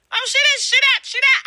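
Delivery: high, sneering mimicry of critics; mocking gossip. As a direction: parrot the haters, mocking and high. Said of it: "You gonna repeat the same shit".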